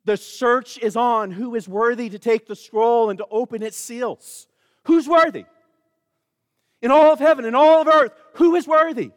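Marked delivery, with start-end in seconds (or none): 4.8-9.1 rising call. Acted. Preacher calls out the scroll question.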